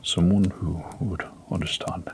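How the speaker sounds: whispered echo of someone who would understand; hushed repeat. Acted. Canadian echoes the doctor's words in a whisper. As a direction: breathe the words back under your breath. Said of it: "repeated the Canadian".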